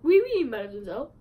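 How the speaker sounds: light mock French accent, lifting at the end; playful French accent on the greeting. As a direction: Timon putting on a hint of French. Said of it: "like a French accent".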